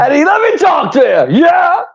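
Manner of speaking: hype shout. Pretend. Mimicking cohost's hype call.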